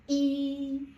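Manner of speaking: E held loud, high and level; high flat tone. Shown as a demonstration. Teacher counts together with pupils, high flat tone. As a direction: keep E up on one note. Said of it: "flat tone is a high-pitched tone".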